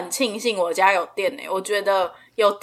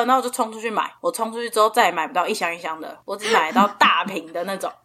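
normal talking voice

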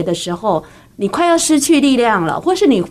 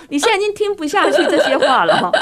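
laughing tease; 5 semitones higher than usual. Real, not acted. Host teases listeners, laughing.

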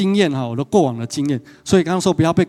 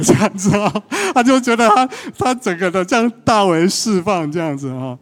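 amused excitement; 7 semitones higher, 4 dB louder than usual. Retold or imitated. Laughing retelling mom's outburst.

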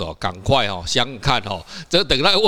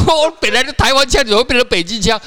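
laughing; 12 semitones higher, 6 dB louder than usual. Real, not acted. Mock outrage over accent.

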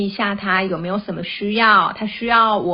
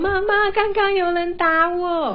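put-on high whiny little-girl plea, voice pitched way up; crying child's voice; 10 semitones higher than usual. Mother imitating her crying child reporting being hit. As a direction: play the tearful kid tattling to mom. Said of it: "哭着跑来".

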